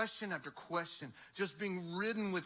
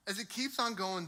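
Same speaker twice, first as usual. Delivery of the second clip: usual speaking voice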